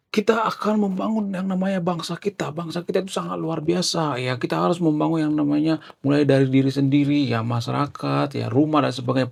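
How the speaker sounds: high, rolling political speech voice; Kang Deddy Mulyadi. Shown as a demonstration. Presenter demonstrating a politician's speech, high oratorical delivery. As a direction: imitate an orator's rising and falling intonation. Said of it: "Kang Deddy Mulyadi itu kalau berpidato itu ya".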